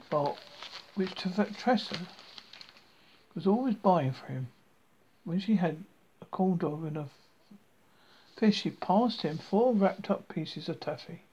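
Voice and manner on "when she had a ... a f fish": ordinary delivery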